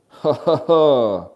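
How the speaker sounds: slow, loud, hammed-up ha ha ha; loud cackle. Real, not acted. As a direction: belly laugh at own joke. Laughing at own joke.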